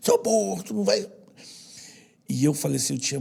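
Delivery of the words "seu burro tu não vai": imitating father's scorn. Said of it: "do próprio meu pai".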